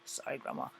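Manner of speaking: unvoiced whispered apology; whispered aside. Real, not acted. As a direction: whisper a quick sorry to Grandma.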